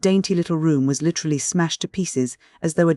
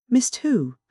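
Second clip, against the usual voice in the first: surprised; 9 semitones higher than usual. A narrator's reading. Startled question in dark.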